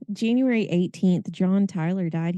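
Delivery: ordinary delivery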